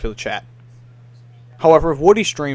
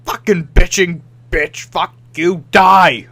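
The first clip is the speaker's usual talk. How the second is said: louder mock furious teammate yelling; mock rage; 3 semitones higher, 8 dB louder, slower than usual. Imitating an angry teammate raging at him. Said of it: "someone's like".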